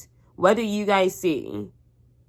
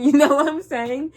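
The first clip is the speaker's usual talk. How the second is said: laughing, seeking agreement; laughing question; 10 semitones higher, 7 dB louder than usual. Real, not acted. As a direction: laugh it out, louder and higher. Laughing at own joke.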